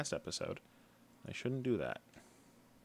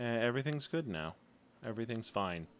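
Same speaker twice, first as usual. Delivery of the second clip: ordinary delivery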